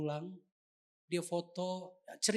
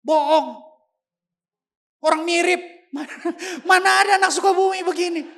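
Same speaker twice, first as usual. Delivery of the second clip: loud, high protest breaking into a laugh; his own mind loudly denying he is adopted; indignant disbelief; 11 semitones higher, 16 dB louder, faster than usual. Retold or imitated. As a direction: refuse to believe it, outraged. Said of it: "otak saya bilang".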